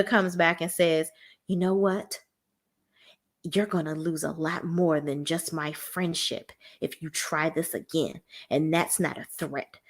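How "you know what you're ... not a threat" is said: soft, cold menacing warning; quiet threat. Retold or imitated. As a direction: lean in and promise it quietly. Heather threatening Shannon.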